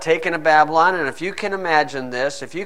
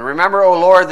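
fervent scripture; 5 semitones higher, 10 dB louder than usual. Acted. Preached scripture.

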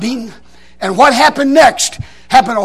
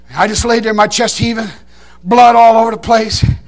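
normal talking voice

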